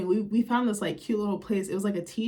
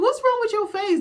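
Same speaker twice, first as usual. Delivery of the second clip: high, loud disgusted cry at a face; incredulous disgust; 14 semitones higher, 6 dB louder than usual. Retold or imitated. Vlogger reacting to Flo's face, high and loud disgust. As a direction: recoil at the sight and shout it out.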